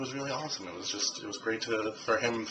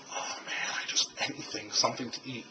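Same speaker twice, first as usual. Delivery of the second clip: whispered craving; 9 dB quieter than usual. Retold or imitated. Hungry, alone, craving food.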